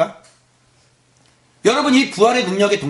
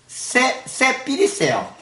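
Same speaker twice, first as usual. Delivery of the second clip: higher, slow Gyeongsang dialect drawl on 쎄 삐리쎄요; 5 semitones higher, much slower than usual. Shown as a demonstration. Pastor jokingly answers the congregation in Gyeongsang dialect. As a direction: say it like a Gyeongsang local, stretched out. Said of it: "경상도 사투리로".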